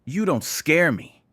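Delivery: loud, high, defiant retort; spat defiance. A narrator's reading. Will defies the man standing over him, spitting it out. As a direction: throw it back in his face. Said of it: "he spat".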